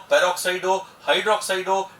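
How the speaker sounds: ordinary delivery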